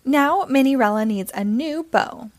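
ordinary delivery, nothing marked

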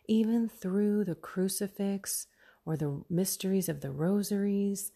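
ordinary talk

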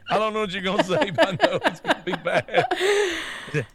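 quick start, then stretched out through laughter; laughing. Real, not acted. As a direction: laugh through the dread of what's coming. Laughing while retelling the clip.

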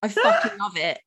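normal talking voice

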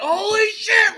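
very high, loud shout of disbelief; excited shout. Retold or imitated. Fan verifying the signing news, shouting high in disbelief. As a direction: yell it out in shock. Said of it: "I was like".

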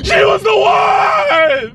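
slow high wailing shout; anguished wail. Acted. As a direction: wail it out, heartbroken. Heartbroken outburst in the car.

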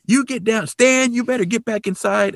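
high-pitched mock calling up to someone; retold yelling. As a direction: act out a scolding order called from afar. Re-enacts man yelling at Stan.

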